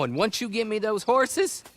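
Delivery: ordinary delivery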